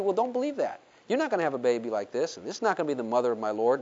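low, soft, quick aside; fast, low mock discouragement; discouraging inner doubt. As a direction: lightly voice a nagging doubter.

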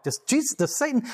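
high, incredulous question; high baffled asking about Satan; puzzled question. Retold or imitated. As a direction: ask it in high disbelief. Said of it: "That's why I'm like".

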